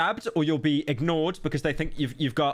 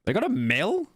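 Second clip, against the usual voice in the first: higher, incredulous question rising at the end; can't believe the number he just read; surprised; 6 semitones higher than usual. Real, not acted. Reading a population figure to the chat, surprised question.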